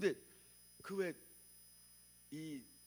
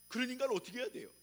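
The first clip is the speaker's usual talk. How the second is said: challenging question; 7 semitones higher, 6 dB louder, much faster than usual. Real, not acted. Rhetorical challenge.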